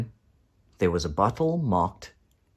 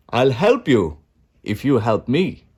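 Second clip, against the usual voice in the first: wizard voice; 2 semitones higher, 5 dB louder than usual. A narrator's reading. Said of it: "said the wizard".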